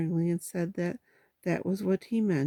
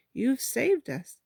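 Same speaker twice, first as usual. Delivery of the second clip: slow, higher-pitched admiration; admiring; 6 semitones higher, slower than usual. A narrator's reading. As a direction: gush slowly over a friend's cleverness. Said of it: "to be the admiring one".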